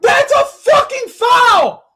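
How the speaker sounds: screaming at the referee, high and loud; furious shout. Real, not acted. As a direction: yell it out in real fury. Raging at ref call in game.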